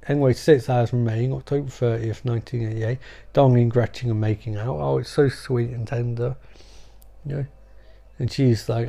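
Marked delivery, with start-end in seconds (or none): none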